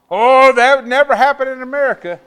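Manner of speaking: high, loud scoffing protest; mock-incredulous outcry; mock disbelief. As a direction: scoff loudly as the naive objector. Mimicking disbelievers of his warning.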